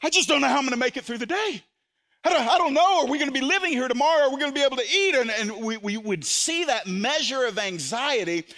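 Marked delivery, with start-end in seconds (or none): 0.0-5.2 put-on higher, fast, louder, panicky child; anxious child. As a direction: voice a frightened child rushing out worries. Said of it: "the child says".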